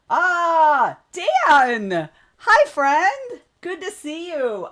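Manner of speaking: high, loud delighted hello; light up to greet a friend; excited greeting. Real, not acted. Streamer greets arriving viewer Dan.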